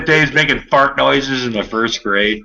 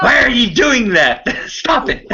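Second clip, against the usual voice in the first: much higher, hammed-up parent telling a kid off; mock scolding parent; 9 semitones higher than usual. Parent scolding a child for armpit farts, hammed-up and high. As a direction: play the fed-up parent at bedtime, squeaky and high.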